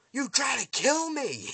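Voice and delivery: loud, high, slow accusation; outraged yell. Retold or imitated. Villain confronts woman who shot at him, outraged yell. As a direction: hammer it out in hammed-up fury.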